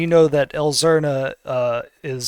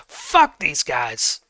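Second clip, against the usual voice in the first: character outburst; much slower than usual. Acted. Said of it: "and he goes".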